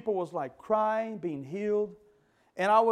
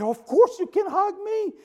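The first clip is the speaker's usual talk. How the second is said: higher, louder burst of glad welcome; joyful yes; 11 semitones higher, 6 dB louder than usual. Retold or imitated. Mother tells her son he can hug her, a glad lifted yes. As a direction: retell her happy answer, lifted and bright.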